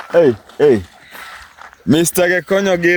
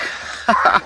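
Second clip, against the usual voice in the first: short breathy chuckle; quick laugh at own antics; 4 dB quieter than usual. Real, not acted.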